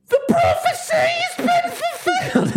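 extremely high falsetto gnome cry; hammed-up squealing proclamation; screaming gnome. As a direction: shriek it in a tiny squeaky voice, without real volume. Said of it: "screaming and screaming".

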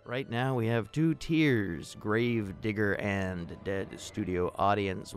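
normal talking voice

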